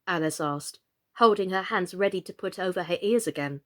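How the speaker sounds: usual speaking voice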